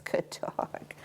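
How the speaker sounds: soft breathy whisper of praise; hushed little good dog. Real, not acted.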